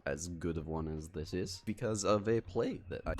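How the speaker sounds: imperfect put-on Scottish accent, softly. Explaining the accent to viewers, in a rough Scottish accent. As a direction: attempt a Scottish accent, keeping it soft. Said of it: "how to do a Scottish accent".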